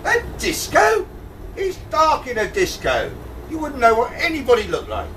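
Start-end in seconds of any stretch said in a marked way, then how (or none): 0.0-1.1 high, slow, appalled echo; incredulous. Acted. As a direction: repeat it as a scandalised question, pitched way up. King scorns prince's disco idea.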